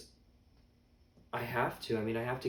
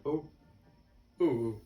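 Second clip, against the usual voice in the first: a short, louder mock burble; imitated cartoon delivery; 4 semitones higher, 6 dB louder than usual. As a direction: lightly mimic his repeated ohs. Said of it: "I love his delivery".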